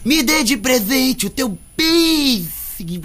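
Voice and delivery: cantado alto e lento com "presente" e "piercing" em chiado, o s virando sh; imitação cantada de fã com a língua presa. Retold or imitated. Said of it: "colocando a língua presa pra eu poder fazer a língua presa". (canta "Me dê de presente o teu piercing" chiando os esses)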